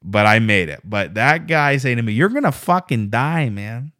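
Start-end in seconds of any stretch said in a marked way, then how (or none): 2.1-3.9 put-on quoting the driver in his Mexican accent; Mexican accent impression. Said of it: "with the kind of accent".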